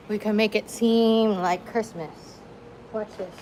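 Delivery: ordinary talk, nothing marked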